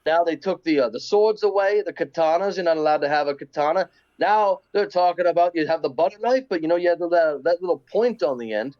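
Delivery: put-on British accent, mock reassuring. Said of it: "the British accent".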